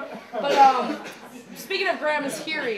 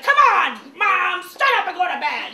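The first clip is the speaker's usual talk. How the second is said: loud, higher nagging call; impatient call; 6 semitones higher, 10 dB louder than usual. Retold or imitated. Mom ordering grandma to bed, loud and impatient. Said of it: "is like".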